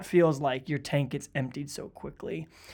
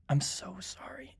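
near-whispered, slow sympathy; hushed, tender consoling; acted condolence; 3 semitones higher, 7 dB quieter, slower than usual. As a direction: softly offer comfort, almost whispering. Models consoling a grieving person.

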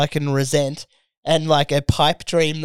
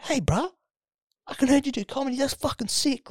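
high, soft mock bro voice; lightly mocking a gym bro; imitating a bro; 10 semitones higher, 6 dB quieter than usual. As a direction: put on a high, breathy-sounding bro impression. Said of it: "just came up and was like".

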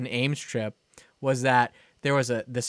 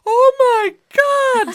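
much higher, louder panicked oh my god; panicked cry; 9 dB louder, slower than usual. Retold or imitated. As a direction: cry it out in alarm. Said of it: "they're like".

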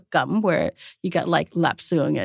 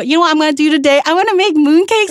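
mock cheerful plan; 9 semitones higher, 7 dB louder, faster than usual. Mocking a wartime family's priorities.

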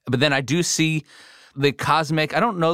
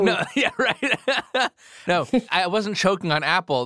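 laughing; 6 semitones higher than usual. Real, not acted. Laughing off a coughing fit.

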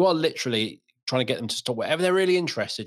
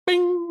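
high-pitched "bing" sound effect; sudden high ringing bing; imitated ping; 4 dB louder than usual. Father imitates daughter's instant head turn, high ping.

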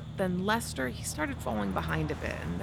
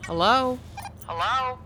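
higher, louder call into the radio; 7 semitones higher, 5 dB louder than usual. Acted. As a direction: call out for an answer. Calling out over the radio.

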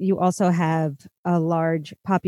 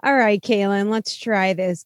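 ordinary talk

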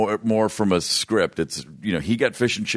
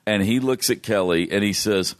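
ordinary delivery

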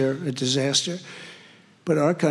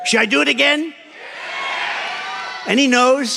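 calling out to crowd; 9 semitones higher than usual. Real, not acted. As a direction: shout the question up to the crowd. Asking rally crowd about repeating poem.

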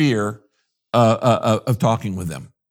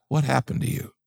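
gentle question; 6 dB quieter than usual. Shown as a demonstration.

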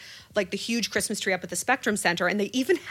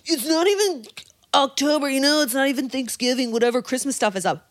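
high, loud whiny mock complaint; mocking complainers; 5 semitones higher, 4 dB louder than usual. Imitating people who gripe about early Christmas, whiny. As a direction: mimic the naysayers, exasperated. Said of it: "everyone craps all over".

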